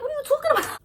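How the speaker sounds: very high and loud, indignant; high indignant outburst at a friend; outraged disbelief. Retold or imitated. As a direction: demand an answer, hurt and shocked. Retold confrontation with Susan.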